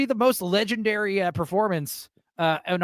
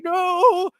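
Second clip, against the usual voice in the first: very high, drawn-out fake wail; hammed-up sobbing; mock crying; 12 semitones higher, 4 dB louder than usual. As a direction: bawl the word in pretend tears. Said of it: "I'm, like, crying".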